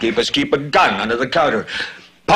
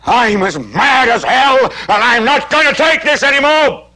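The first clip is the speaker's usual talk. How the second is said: angry yell; 7 semitones higher than usual. Acted. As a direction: yell it out the window, drawing out the words. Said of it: "stick your head out and yell".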